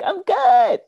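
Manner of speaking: high, loud, bright "I'm good"; chirpy retold reply. Answering her boss, a loud high cheerful "I'm good". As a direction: sing it out like nothing's wrong.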